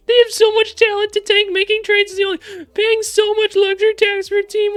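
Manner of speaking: very high, loud, whiny squeal; annoying commenter voice. Retold or imitated. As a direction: read it like an irritating bug. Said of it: "that's how I read all of your guys' comments".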